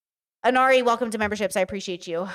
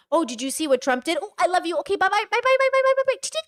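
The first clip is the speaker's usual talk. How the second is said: much higher, rising chain of byes; sing-song goodbye; 7 semitones higher than usual. Retold or imitated. Streamer mimicking hanging up on her family, squeaky sing-song byes. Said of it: "I talk to them when it's like".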